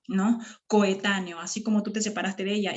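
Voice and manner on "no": usual speaking voice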